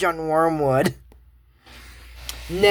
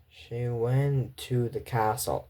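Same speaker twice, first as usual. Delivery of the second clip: slow, low, soft trance drone; slow metronome-like monotone under a spell; hypnotized metronome voice; 6 semitones lower, 8 dB quieter, much slower than usual. Acted. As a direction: report like an entranced servant, word by word. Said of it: "like some kind of metronome voice".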